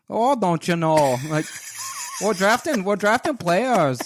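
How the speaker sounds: loud, high, drawn-out mock Minnesotan sliding into Irish; badly faked Minnesota accent, almost Irish; botched Minnesota accent. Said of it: "that's like an Irish".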